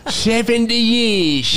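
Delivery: a hint of a creaky old man. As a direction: stretch it out like a lightly voiced old geezer. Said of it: "No, I just talk normal for that".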